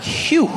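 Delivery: high, loud, falling "phew"; sigh of relief. Acted. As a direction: blow out a big relieved phew. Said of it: "I was like".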